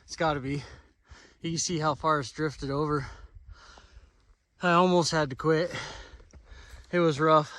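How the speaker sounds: out of breath from the climb; panting between words; heavy breathing. Real, not acted. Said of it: "sorry, I'm breathing hard".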